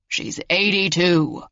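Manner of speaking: put-on slow, drawn-out old-man call; calling from kitchen. A narrator's reading. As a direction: call out to the next room, without real volume. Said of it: "Marvin yelled from the kitchen".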